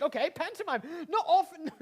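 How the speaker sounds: normal talking voice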